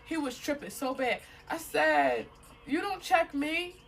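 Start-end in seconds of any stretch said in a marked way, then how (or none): 2.7-3.8 high-pitched retold retort; defiant. Woman excusing herself from her controlling date, defiant. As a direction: push back at him in a raised pitch.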